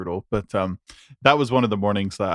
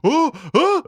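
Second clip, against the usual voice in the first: much higher, giddy; freaking out; 11 semitones higher, 3 dB louder than usual. Retold or imitated. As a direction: gasp like a starstruck fan. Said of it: "freaked out meeting [name]".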